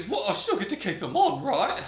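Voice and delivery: high, quick put-on prisoner voice; John. Prisoner John pleading to keep his arms, high voice. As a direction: protest in a high, laughing character voice. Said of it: "he's like".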